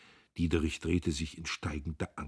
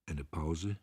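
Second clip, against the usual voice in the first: ordinary delivery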